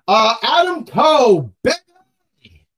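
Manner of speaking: high, drawn-out catchphrase yell. Acted. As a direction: holler it like a ring entrance chant. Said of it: "I need to stop yelling".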